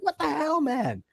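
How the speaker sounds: very high, startled what the hell; shocked outburst. Retold or imitated. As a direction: squeal it in disbelief. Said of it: "I'm like".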